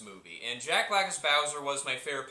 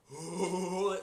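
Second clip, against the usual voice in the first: Seth Rogen weed laugh; 3 semitones higher than usual. Retold or imitated. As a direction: imitate a stoner's huh-huh laugh. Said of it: "like, weed voice for Donkey Kong".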